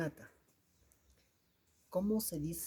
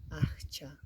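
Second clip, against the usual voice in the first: whisper. Shown as a demonstration. Whispered answers in a language lesson.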